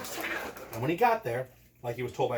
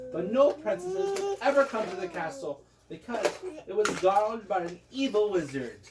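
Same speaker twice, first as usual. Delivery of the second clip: usual speaking voice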